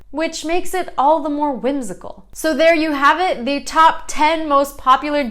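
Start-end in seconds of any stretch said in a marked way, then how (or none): none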